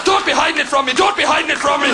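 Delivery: laut gerufen mit irischem Akzent; Irish woman yelling. Retold or imitated. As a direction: als aufgebrachte Irin schreien. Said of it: "she went fucking nuts".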